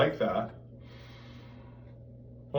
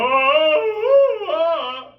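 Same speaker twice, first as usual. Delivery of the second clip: very high, loud note that cracks; high sung ah that cracks; cracking sung note; 11 dB louder than usual. Shown as a demonstration. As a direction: push too much breath so it breaks. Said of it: "and then crack".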